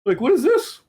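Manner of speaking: baffled Bills. Acted. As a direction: squeal it like a hopelessly confused team. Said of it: "Bills are like".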